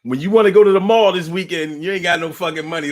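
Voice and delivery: ordinary talk, nothing marked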